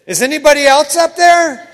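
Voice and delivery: high, loud plea upward; desperate call. Retold or imitated. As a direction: yell up hoping for a different answer. Man hanging from cliff branch.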